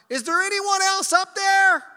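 full high shout to the heavens; crying out. Acted. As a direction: holler upward, desperate for another rescuer. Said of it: "The man cried out".

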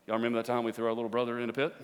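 low, quick, hushed imagined chat; playfully murmured recollection, rising at the end; mock furtive aside. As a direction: mutter it fast like uneasy cellmates. Imagines jailed brothers talking among themselves.

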